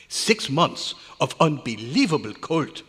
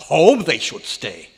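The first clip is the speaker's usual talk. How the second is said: scornful retort; 2 semitones higher, 10 dB louder than usual. Acted. Scientist scoffs at trustees' wives.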